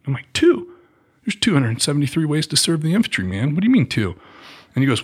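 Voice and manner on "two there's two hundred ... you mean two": can't believe what he just heard; indignant disbelief. Retold or imitated. Recruit protesting to the recruiter, high incredulous. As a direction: pitch jumps up, incredulous.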